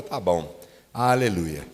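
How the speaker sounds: "aleluia" resignado, bem mais grave e mais baixo; louvor sem vontade. Retold or imitated. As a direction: ceda a contragosto, grave. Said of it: "mas não com muita vontade". (louvor obrigado, de má vontade, em tom grave)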